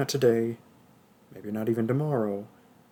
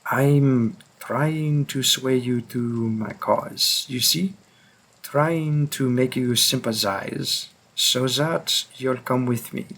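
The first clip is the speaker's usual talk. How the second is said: put-on French accent. Hunter forcing the listener to come along, French accent. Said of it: "tried to do a French accent".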